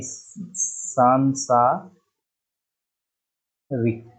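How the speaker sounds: सांसारिक very slowly, split as saan-saa-rik; drawn-out syllables. Shown as a demonstration. Teacher writing out the rule, सांसारिक syllable by syllable. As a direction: stretch each long aa in सांसारिक. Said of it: "उसमें बड़ा का मात्रा".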